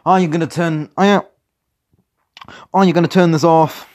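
loud, high-pitched yell. Acted. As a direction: shout it at the guards. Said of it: "I heard a voice yell".

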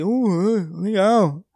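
nasal mumble through a mask; muffled fanho voice. Retold or imitated. Masked man answers a fan minimally, muffled nasal voice. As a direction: imitate talking with as few clear words as possible. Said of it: "minha voz com máscara e fanho".

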